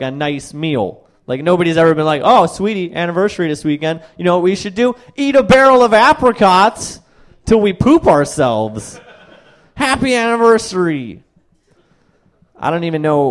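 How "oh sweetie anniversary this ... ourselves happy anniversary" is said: high, loud doting spouse voice; mock sweet spouse. Comic playing a spouse planning an anniversary, hammed-up doting voice. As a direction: gush like a lovestruck partner.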